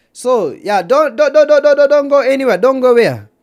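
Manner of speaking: high, loud rapid-fire plea; urgent calling out. Real, not acted. Streamer begging viewers not to go anywhere, loud and urgent.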